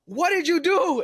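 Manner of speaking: high, loud demand; alarmed mom. Retold or imitated. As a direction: demand an answer like a panicked mother. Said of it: "then she goes".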